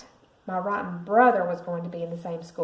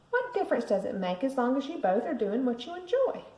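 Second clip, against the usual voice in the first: mom's voice; 5 semitones higher than usual. A narrator's reading. Mom calming the kids.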